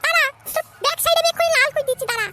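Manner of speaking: very high-pitched cartoon mother, irritated; high put-on mother voice; scolding mother. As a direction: scold the boy in a squeaky, high mum voice. Skit mother mocks and threatens.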